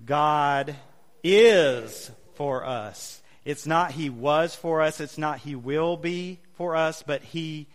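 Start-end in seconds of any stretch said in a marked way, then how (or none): 0.0-3.2 "is" loud and drawn out after a pause; stress on is. Shown as a demonstration. Preacher reading it again with the congregation, stress on "is". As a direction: pause after "God", then lean hard on "is". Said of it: "emphasize a different word".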